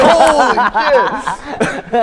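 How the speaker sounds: high, slow, cracking up; laughing shock. Retold or imitated. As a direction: squeal it out through laughter. His shocked reaction, laughing.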